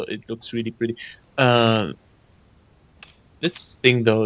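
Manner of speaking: ordinary talk, nothing marked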